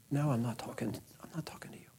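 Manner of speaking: sudden hushed whisper off to the side; real whispered aside to the iPad mid-story. Real, not acted. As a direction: drop to a whisper to hush the device.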